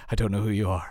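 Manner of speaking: low, grave Northern Irish menace; the Taken phone call, deadpan and low; Liam Neeson impression. Friend quotes the Taken phone call, low grave Neeson voice. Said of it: "you have to always just say".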